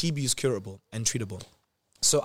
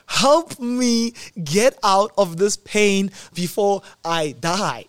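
loud, high, drawn-out cry for help; panicked plea; 6 semitones higher, 5 dB louder, much slower than usual. Retold or imitated. Patient grabbing the nurse by the shoulder, mock panicked plea. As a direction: beg dramatically, stretching out each word.

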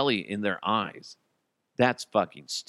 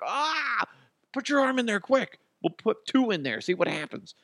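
mock kid; 12 semitones higher than usual. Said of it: "We're like".

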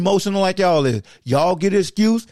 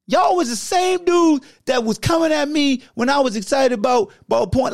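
outraged disbelief; 7 semitones higher than usual. Real, not acted. Ranting at fans who want the coach fired, outraged shout.

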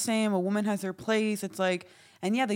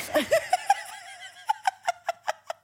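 high squealing laugh. Real, not acted. Friends laughing at a story.

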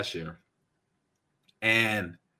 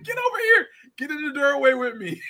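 urgent mom. Retold or imitated. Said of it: "She's like".

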